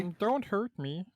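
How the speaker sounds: high-pitched cartoon frog. Shown as a demonstration. Player demonstrating a voice for the frogman, high froggy pleading. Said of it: "Can we get a frog voice?".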